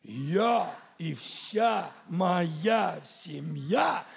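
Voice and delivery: ordinary delivery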